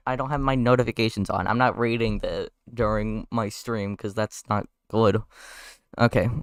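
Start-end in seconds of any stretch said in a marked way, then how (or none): none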